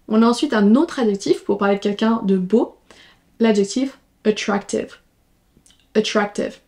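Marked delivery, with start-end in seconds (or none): none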